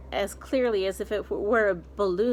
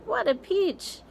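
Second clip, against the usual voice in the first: much higher, put-on gleeful squeal; aunt hopping about, squealing; 11 semitones higher than usual. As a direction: squeal it even more shrilly in glee. Aunts dancing in excitement.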